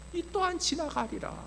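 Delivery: gentle reassurance. Retold or imitated. God reassuring the worried pastor, soft gentle voice. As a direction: offer it very softly in a lifted tone. Repeats God's reassurance, higher.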